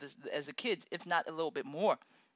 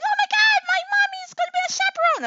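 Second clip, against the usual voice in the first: very high, fast, loud squeal; excited little kid; 10 dB louder, faster than usual. Retold or imitated. Daughter thrilled her mommy is chaperone, squealing high. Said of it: "my kid is looking at me, like, all happy".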